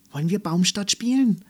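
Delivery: put-on a flushed little girl who can't wait to play; excited child's question; eager question. A narrator's reading. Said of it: "mit ganz heißen Wangen".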